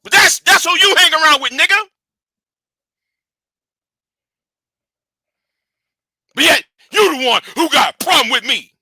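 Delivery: real worked-up outburst, loud and high. Real, not acted. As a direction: get heated and yell the accusation. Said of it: "I got myself all worked up".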